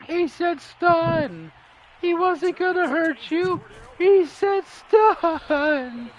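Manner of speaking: high, drawn-out anguished cry; hammed-up tearful protest; Robot Chicken character. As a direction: wail it slowly like a distraught cartoon character. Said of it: "Robot Chicken thing where it's like".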